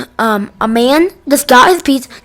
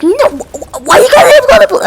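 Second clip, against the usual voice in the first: very high and fast, panicking; panicked squeal; 12 semitones higher than usual. Retold or imitated. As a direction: squeak it out in a high, frantic kid voice. Kid panics at Karen's threat.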